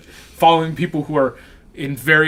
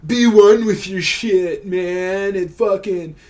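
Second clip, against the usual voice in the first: slow, high, hammed-up hippie guru; mock guru; 6 semitones higher, 6 dB louder, slower than usual. Friend mocks poop talk in slow guru voice. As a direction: preach oneness like a stoned guru. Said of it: "preachy mock guru advice".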